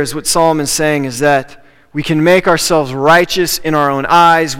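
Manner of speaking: ordinary talk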